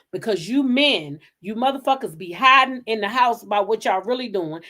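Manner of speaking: ordinary delivery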